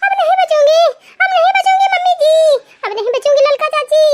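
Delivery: high, loud anguished crying out; crying out in pain. Acted. Woman in labor cries to mother-in-law she won't survive.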